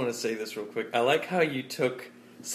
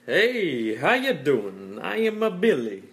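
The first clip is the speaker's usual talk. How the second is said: drawn-out, loud, playful hello; goofy greeting; 5 semitones higher, 6 dB louder, slower than usual. Pretend. Billy told to say hi on the podcast, goofy greeting. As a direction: ham up a silly hello, pitch swooping high.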